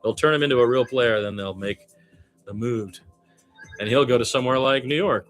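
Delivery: usual speaking voice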